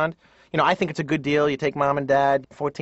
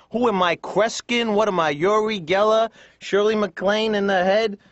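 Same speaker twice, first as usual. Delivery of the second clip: New York sarcasm; 4 semitones higher than usual. Acted. Airport clerk to man with lost luggage, sarcastic New York style. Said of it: "typical New York style".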